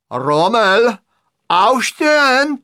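high, loud military shout; barked command. A narrator's reading. Hitler figure ordering the patient to stand, barked command.

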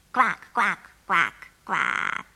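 imitated duck chatter; repeated duck quacks. A narrator's reading. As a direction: separate low quacks, put on. Duck voice answers the phone.

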